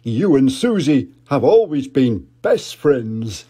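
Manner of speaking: jolly put-on dad voice; Daddy Pig. Daddy Pig remembering, hearty dad voice. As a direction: chuckle it out as a big daddy pig. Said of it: "he said".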